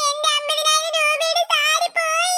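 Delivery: wailing over a ruined sari; bawl, then lament through sobs; crying. Acted. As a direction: melodramatic weeping. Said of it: "കരയല്ലേ".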